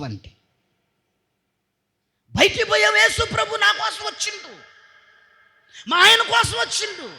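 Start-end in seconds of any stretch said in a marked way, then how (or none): 2.4-7.1 high, loud sarcastic mimicry; mock the churchgoer's showy words; mocking hypocrite. Mocks hypocrite's testimony.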